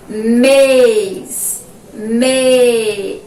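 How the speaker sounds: Mês called out loud with a closed ê; teacher's loud model word. Shown as a demonstration. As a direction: project Mês with the closed vowel. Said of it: "Vamos pronunciar a palavra?".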